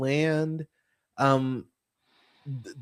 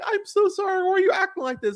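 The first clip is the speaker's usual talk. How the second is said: much higher, whiny pleading; mock whiny apology; faster than usual. Spy friends pleading after being caught, whiny mock voice. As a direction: simper like a caught liar playing the victim. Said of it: "they're like".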